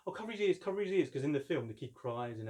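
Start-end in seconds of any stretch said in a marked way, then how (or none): 0.1-1.1 high, loud mock panic; mock alarm. Siblings in church shout in hammed-up alarm. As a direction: cry out in pretend horror.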